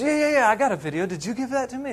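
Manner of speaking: high-pitched eager reply; acted-out youngster's excitement; excited boy. Excited boy answering in story.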